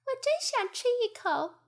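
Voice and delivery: high little fly voice; a fly craving the honey; greedy fly. Acted. A fly in the story. (馋嘴的苍蝇)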